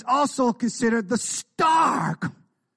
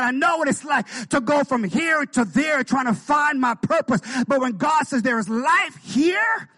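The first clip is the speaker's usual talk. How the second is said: full-force preaching; 6 semitones higher than usual. Acted. Preacher rousing the church to full shout. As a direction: build the praise to a shout.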